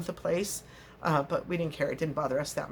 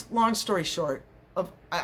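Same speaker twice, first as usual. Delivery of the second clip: ordinary talk, nothing marked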